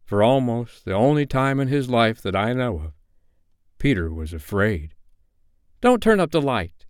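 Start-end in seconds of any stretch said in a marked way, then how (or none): 5.8-6.8 put-on high, pleading boy's cry; mildly panicked, pitched right up; frightened cry. A narrator's reading. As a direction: cry out to stop them. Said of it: "he cried".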